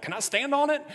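put-on excited kid question. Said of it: "and I was like".

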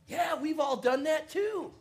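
high, slow mock-casual friend voice; teasing friends. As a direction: play it cool like a teasing kid. Friends answer in retold story.

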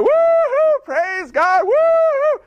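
high whooping cries of praise; squealing woo-hoo, praise God; joyful whoops. Acted. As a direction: whoop it up like a man just healed. Healed man praising in temple.

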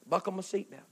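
ordinary talk

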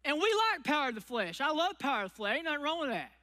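normal talking voice

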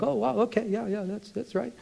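high, breathy-soft fake agreement, eyes rolling in the voice; sarcastic mock agreement. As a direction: humor them with an airy, high "oh wow, okay". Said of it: "And you're like".

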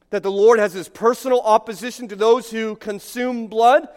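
ordinary talk, nothing marked

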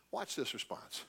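ordinary talk, nothing marked

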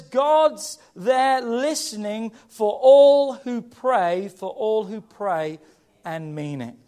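slow scripture line for the congregation; emphatic scripture quoting. Acted. Preacher quotes Message Bible emphatically.

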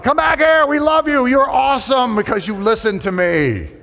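hammed-up desperate church crowd; mock pleading shout. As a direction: call after them, overeager and loud. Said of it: "we're like".

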